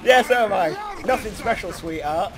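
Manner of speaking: ordinary talk, nothing marked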